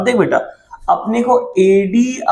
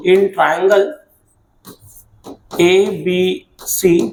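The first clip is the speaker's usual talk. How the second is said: slow dictation; much slower than usual. Shown as a demonstration. Teacher dictating the given data.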